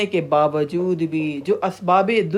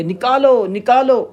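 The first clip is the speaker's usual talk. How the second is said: louder, higher pleading yell; clamouring cry; 5 semitones higher, 5 dB louder, slower than usual. Acted. People in Hell making a great noise to get out, loud clamour. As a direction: cry out to be let out, each word falling. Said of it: "بہت شور مچا رہے ہیں کہ".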